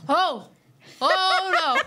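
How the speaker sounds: rising laughing "oh no"; mock horror with a laugh; mock dismay. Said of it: "You're like".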